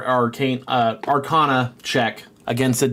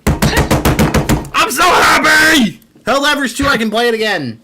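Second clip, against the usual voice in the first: excited shout; 14 semitones higher, 6 dB louder, slower than usual. Real, not acted. GM thrilled about the game.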